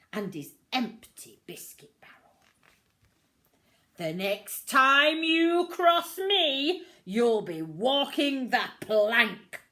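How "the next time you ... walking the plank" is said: loud, slow threatening roar; roaring captain. A narrator's reading. Captain threatening Pete with the plank, loud roar. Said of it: "roared the captain".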